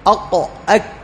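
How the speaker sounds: "أق" closed at the uvula, "أك" released further forward; articulation demo. Shown as a demonstration. Teacher contrasts the tongue positions, "أق" uvular then "أك" velar. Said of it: "فالقاف فوق والكاف".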